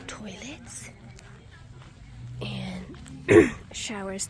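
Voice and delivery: hushed whisper. Real, not acted. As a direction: whisper so others sleep. Quiet tent-camp vlog at night.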